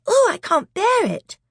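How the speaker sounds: put-on high, loud distressed girl; little girl who can't bear it; frightened girl. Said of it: "said Lucy".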